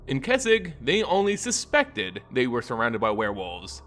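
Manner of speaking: ordinary delivery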